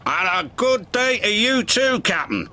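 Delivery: cheery retort. A narrator's reading. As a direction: answer a grump with a hearty good day. Salty answers cranky crane's snap.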